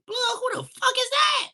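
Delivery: high, loud startled yell; high surprised holler; retold shout. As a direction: shout back at a stranger. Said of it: "I'll be like".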